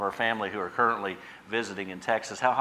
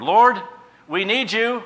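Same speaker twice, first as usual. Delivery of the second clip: much higher, louder cry; calling on the Lord; 12 semitones higher, 11 dB louder, slower than usual. Pretend. Worshipper crying out to the Lord, much higher louder cry. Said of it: "we just seek the Lord".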